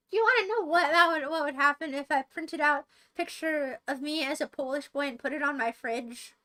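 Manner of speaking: ordinary talk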